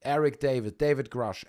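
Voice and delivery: ordinary talk, nothing marked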